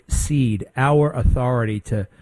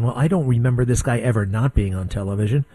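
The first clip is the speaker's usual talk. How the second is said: usual speaking voice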